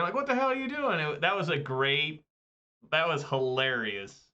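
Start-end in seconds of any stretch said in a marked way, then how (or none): 0.1-1.0 squeaky high disbelieving voice; incredulous high yelp. Retold or imitated. Retelling South Park, the teacher's high shocked reply. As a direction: shriek it in mock disbelief. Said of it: "he, like, wipes away a tear".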